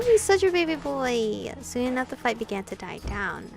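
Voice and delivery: usual speaking voice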